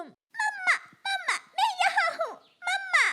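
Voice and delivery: put-on very high child-bird cry; desperate calling. Lost little bird answering mother, desperate calling. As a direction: call out to be found.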